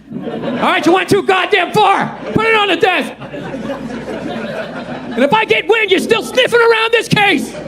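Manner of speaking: angry police captain. Pretend. Movie police chief yelling at a rogue cop, furious. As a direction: bark him off the case like a fed-up captain.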